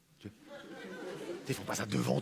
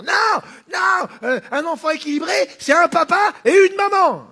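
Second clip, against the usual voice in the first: shrill protester voice; 14 semitones higher, 9 dB louder than usual. Acted.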